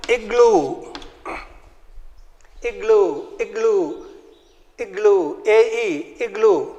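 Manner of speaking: igloo with a short i, soft and repeated. Shown as a demonstration. Teacher drilling igloo for the class, soft. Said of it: "I will sound like A, E".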